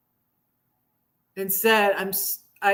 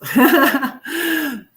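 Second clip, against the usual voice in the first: short higher, louder laugh; 4 semitones higher, 6 dB louder than usual. Real, not acted. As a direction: burst out laughing after the remark. Jokes about paying less rent.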